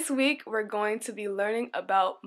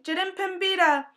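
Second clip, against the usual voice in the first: a crowd's cheer, a touch higher; mock shouting; 8 semitones higher, 4 dB louder than usual. Villagers greet their new chief, mild shout. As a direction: call out the chief's name. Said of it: "Many came out shouting".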